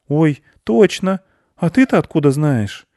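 put-on astonished little boy voice; surprised boy. A narrator's reading. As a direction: pipe up in amazed recognition.

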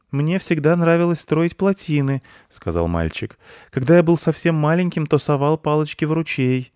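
put-on high eager boy's voice; a boy proudly sharing a memory; young boy. A narrator's reading. (мальчик, высоко и увлечённо)

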